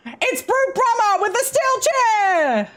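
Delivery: high, slow ringside call; wrestling commentator. Acted. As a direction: call the big entrance like ringside.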